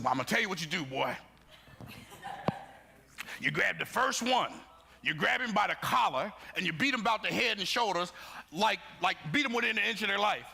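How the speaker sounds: high, emphatic imitation of a rallying father; dad's battle speech. Father coaching his son against bullies, rousing speech. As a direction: deliver dad's orders like a war cry. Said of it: "like it was his William Wallace moment".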